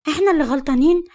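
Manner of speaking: put-on girl snaps back at her father, bitter and heated; resentful. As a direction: throw it back at him, piqued. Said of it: "حبيبة بغيظ".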